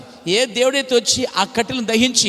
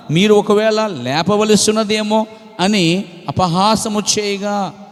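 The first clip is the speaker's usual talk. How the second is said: preached scripture reading; 4 dB louder than usual. Acted. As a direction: proclaim the verse to the congregation, loud. Reading scripture at full force. (గట్టిగా, నెమ్మదిగా వాక్యం చదవడం)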